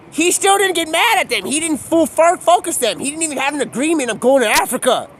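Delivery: high and loud, worked up; heated rant. Real, not acted. Ranting, pitch and level up.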